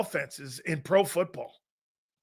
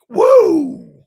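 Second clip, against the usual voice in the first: excited whoop; 11 semitones higher, 13 dB louder than usual. Real, not acted. Reacting to Cowboys pick.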